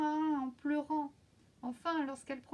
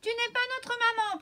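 much higher, louder little goats protesting; protesting kids; 12 semitones higher, 10 dB louder than usual. A narrator's reading. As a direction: kids shouting back at the door. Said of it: "protestèrent les petits".

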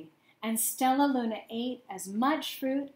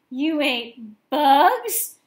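slow, louder, disbelieving; stunned stutter; 4 semitones higher, 11 dB louder, much slower than usual. A narrator's reading. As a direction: drag out the shock, voice raised. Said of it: "stuttered one".

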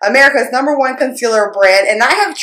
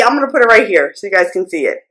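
normal talking voice

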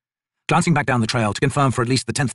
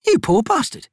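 lightly raised soldier voice; brisk, higher woman soldier; Picker's voice; slower than usual. Acted.

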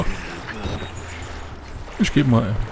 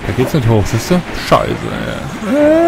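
ordinary delivery

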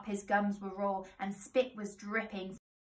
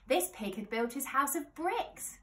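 plain reading voice

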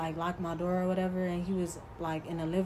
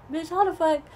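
stunned disbelief; 10 semitones higher, 6 dB louder than usual. Real, not acted. Shocked at him following her.

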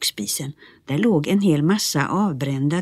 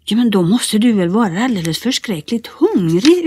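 exclaiming; 6 semitones higher, 5 dB louder than usual. Acted. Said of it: "utbrast Bertil".

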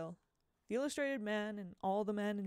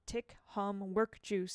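plain reading voice

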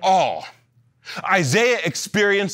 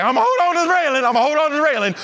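high, fast nervous babble; scared at the railing; 6 semitones higher, much faster than usual. Retold or imitated. Preacher at the Grand Canyon edge, high fast frightened chatter.

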